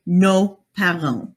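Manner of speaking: "nos parents" said slowly, with a silent s; "nos parents" slowly and clearly, French style; French pronunciation. Shown as a demonstration. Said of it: "so we say".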